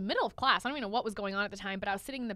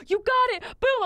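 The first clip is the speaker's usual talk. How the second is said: imitating hyped friend; 13 semitones higher, 9 dB louder than usual. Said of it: "He's like".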